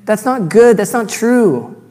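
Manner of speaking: high, loud, slow mock protest; retelling students yelling at the proposal; imitated students shouting. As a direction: drag out each no like an outraged class. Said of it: "and they say".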